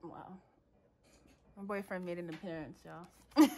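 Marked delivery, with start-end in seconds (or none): 2.8-3.6 bursting into laughter; laughing. Real, not acted. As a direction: laugh through the word. Boyfriend walks in, she laughs.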